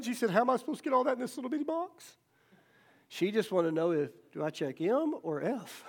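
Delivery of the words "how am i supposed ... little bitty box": higher, hurried mock-tearful little girl; imitating upset child. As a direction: play a mildly distressed child, quick and high. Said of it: "the little girl just started crying".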